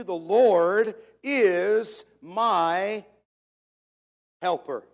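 very slow, loud, each word held; emphatic recitation. Shown as a demonstration. Preacher repeating a loved verse to his congregation, slow and emphatic. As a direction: proclaim it word by word.

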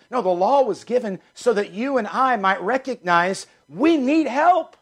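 rising, ringing preacher's call; fervent preaching. Acted. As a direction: lift the congregation toward the Savior. Pastor preaching to congregation.

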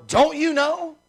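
high, loud, astonished question; acted disbelief. Preacher explaining the verse's meaning to the congregation, incredulous. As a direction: press it as if it's obvious. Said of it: "That's what this what is".

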